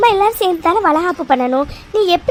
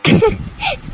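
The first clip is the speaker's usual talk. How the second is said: amused laugh; 10 semitones higher than usual. Acted.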